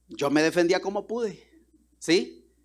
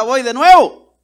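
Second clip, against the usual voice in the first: determined; 5 semitones higher, 12 dB louder, slower than usual. Retold or imitated. Woman vows to try again.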